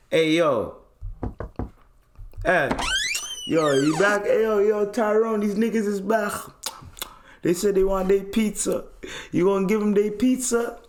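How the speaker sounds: brash street voice calling out; mock rowdy neighbor. Calling friend Tyrone over.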